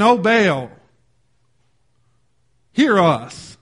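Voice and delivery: slow, loud retold invocation; calling on Baal. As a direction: call out to a distant god, drawn out. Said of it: "they called on the name of Baal".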